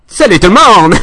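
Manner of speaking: loud, high burst of a hello; big greeting. Real, not acted. Host greeting the audience with a loud cheer. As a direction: throw the greeting out loud to everyone.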